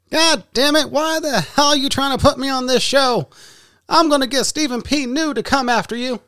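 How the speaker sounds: put-on high, loud, angry old-man rant; imitating Jim Cornette angrily scolding the hosts; Jim Cornette impression. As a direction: rant like a furious grumpy commentator. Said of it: "News with Jim Cornette".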